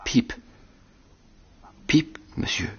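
put-on high, breathless whisper of a scared boy; frightened whisper. Small boy whispering his name to the convict, frightened. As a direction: whisper it high as if frozen with fear.

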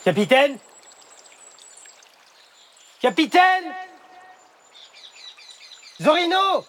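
high, loud, anxious calls into emptiness; calling out. Acted. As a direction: shout their names into the distance. Alone, calling missing companions.

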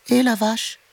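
high, tiny kitten call; small kitten voice. A narrator's reading. A tabby kitten hails the cow from behind, in a small high voice. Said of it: "une petite voix derrière elle retentit".

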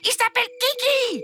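put-on high, whiny child voice listing wishes; a spoiled little prince insisting; demanding child prince. Spoiled prince demanding everything from his parents, high and whiny.

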